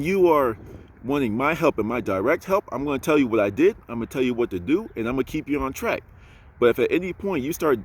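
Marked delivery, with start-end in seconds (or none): none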